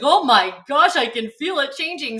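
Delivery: retold "oh my gosh" outburst; mock alarm. Telling listeners of feeling the face change, mock alarm.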